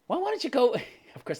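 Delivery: normal talking voice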